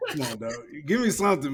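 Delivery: breaking into laughter, pleading; can't hold back the laugh. Real, not acted. Host reading the apology, cracking up laughing. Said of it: "I'm trying not to laugh".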